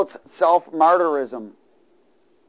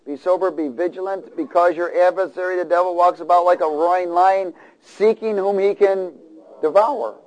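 rising, louder preacher build; preaching; 4 semitones higher, 3 dB louder than usual. Acted. Preaching scripture with force.

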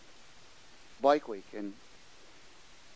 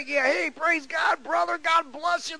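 rowdy biker greeting; 14 semitones higher, slower than usual. Retold or imitated. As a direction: holler it like a tipsy biker. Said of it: "He says".

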